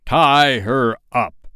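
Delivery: loud, slow pirate captain's order; shout. Acted. Hook cutting off the boys, loud slow shout. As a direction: bellow it with drawn-out words. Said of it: "he shouted".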